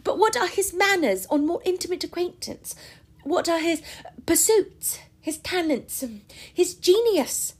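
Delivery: put-on high, pressing young woman's voice; eager romantic girl. A narrator's reading. Marianne voiced by reader.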